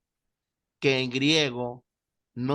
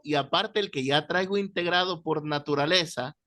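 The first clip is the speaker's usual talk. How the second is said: ordinary talk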